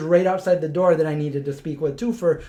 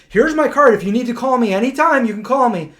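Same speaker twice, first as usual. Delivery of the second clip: higher, louder eager voice of the general; mock friendly offer; 7 semitones higher, 5 dB louder than usual. General offering his card to the new interviewer, bright and eager. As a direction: hand over the card brightly, hammed up. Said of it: "he goes".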